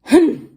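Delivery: loud, high mimicked "hmph"; parrot's grumpy hum. A narrator's reading. Parrot copying the grouchy pirate, loud grumpy hum. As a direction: echo a short grouchy hum. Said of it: "And the parrot says".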